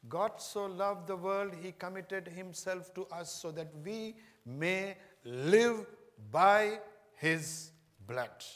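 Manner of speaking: slow, drawn-out solemn quote; solemn declaration. Real, not acted. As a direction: weigh every word of the verse.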